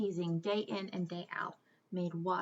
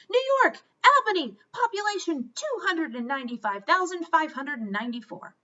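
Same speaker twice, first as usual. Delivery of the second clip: high, loud eager answer; know-it-all blurting the answer; interrupting know-it-all; 7 semitones higher, 8 dB louder than usual. Acted. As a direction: cut in and show off. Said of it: "Camilla interrupted".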